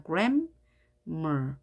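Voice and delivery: "grammar" split as gram, mer; gram, a gap, then mer; syllable by syllable. Shown as a demonstration. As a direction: read the halves of "grammar" separately. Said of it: "따로따로 읽습니다".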